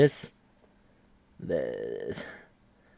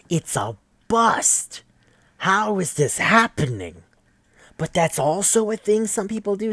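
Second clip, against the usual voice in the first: shocked outcry; 10 semitones higher, 4 dB louder than usual. Real, not acted. Reacting to the anime twist, high shocked outcry. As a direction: throw up hands at the twist.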